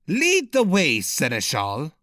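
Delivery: commanding prince. A narrator's reading. Amorlik gives an order.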